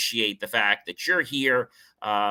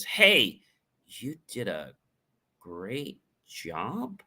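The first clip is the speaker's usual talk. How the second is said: doubting praise; 3 dB quieter, much slower than usual. Shown as a demonstration. Coach doubting you know what you're doing, unconvinced. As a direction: trail into a quiet, rising, unconvinced compliment. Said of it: "I really don't think you know what you're doing".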